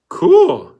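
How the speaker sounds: put-on very high, loud impressed boy; impressed teen yelp; Zigzag. A narrator's reading. Zigzag voiced in read story.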